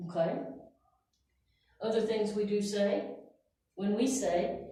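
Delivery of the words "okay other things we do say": normal talking voice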